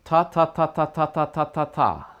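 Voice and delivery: even repeated ta; single tonguing drill; tonguing syllables. Shown as a demonstration. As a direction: model ta ta ta for the student. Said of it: "do this first".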